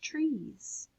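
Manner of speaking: each word set apart in a slow, measured read; slow storybook poem voice. Acted.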